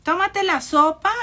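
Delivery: high, loud imitated parental order; parent urging child. Parent telling child to eat soup, imitated urging. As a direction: imitate a mother pushing food. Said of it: "antes nos decían".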